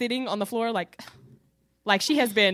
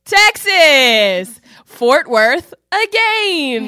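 loud, drawn-out groan of a reveal; fed-up announcement, stretched slow; exasperated exclaim; 4 semitones higher, 14 dB louder, much slower than usual. Real, not acted. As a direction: shout it out like bad news you saw coming. Revealing the trip destination, exasperated.

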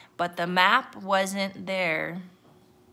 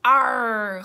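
loud, higher pirate growl-word; pirate holler; 4 semitones higher, 6 dB louder than usual. A narrator's reading. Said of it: "hollered Braidbeard".

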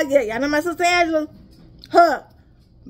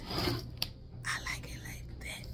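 breathy whispered aside, slowed right down; 20 dB quieter, much slower than usual. Real, not acted. To viewers about loving lemon tea, whispering. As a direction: savor it like a secret.

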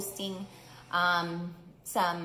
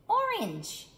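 much higher, bright little mouse voice; delighted reveal; 14 semitones higher, 4 dB louder than usual. Acted. Mouse showing off the orange puddle, high delighted squeal. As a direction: squeal the discovery. Mouse announces the new color.

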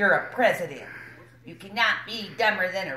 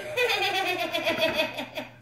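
high giddy cackle; a delighted, hammed-up chuckle; character laugh; 10 semitones higher than usual. [name] celebrates being elected, high giddy cackle.

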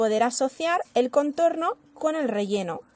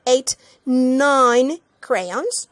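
slow; 4 dB louder, much slower than usual. Acted. Counting aloud to children.

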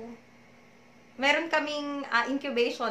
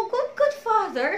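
higher, breaking into tears; crying; 8 semitones higher than usual. Retold or imitated. Praying to the Lord, openly crying. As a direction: let the voice crack as you cry it. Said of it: "I was crying na sabi ko".

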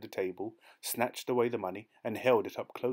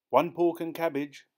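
higher and louder call to the kitchen; shouted order; 7 semitones higher, 6 dB louder than usual. A narrator's reading. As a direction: shout the order toward the back. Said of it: "the waiter shouted".

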